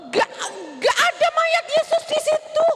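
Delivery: high alarmed exclaim. Acted. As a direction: panic rising in the voice. Finding body gone.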